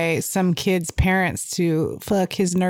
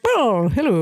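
lightly imitated British man's greeting; a hint of mock British accent; British dude. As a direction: do a quirky British hello. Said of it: "The, like, British dude that was like".

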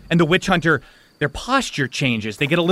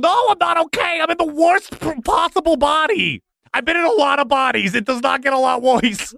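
distressed possessing spirit; 10 semitones higher than usual. Acted. Spirit trapped in the worst body complains, distressed. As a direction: wail about your misfortune, high.

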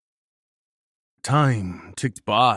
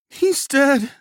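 high, loud distraught cry; sobbing; 9 dB louder than usual. A narrator's reading. Nagel finding the wounded friend, sobbing. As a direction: wail it out in grief.

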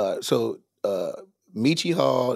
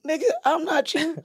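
high mock retort; 13 semitones higher than usual. Retold argument with roommate.

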